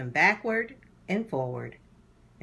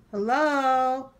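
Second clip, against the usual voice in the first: high, louder calling hello; 8 semitones higher, 4 dB louder, much slower than usual. Acted. Herb calls for Muriel from hiding, high and louder. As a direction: call out to find her.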